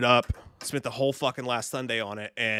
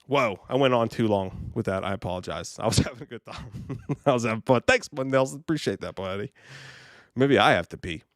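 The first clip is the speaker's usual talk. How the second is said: ordinary talk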